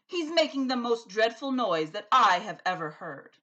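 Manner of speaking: loud, exasperated king; king crying out. A narrator's reading. The king complains about the giant's noise. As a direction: cry out in dismay. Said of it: "The king cried".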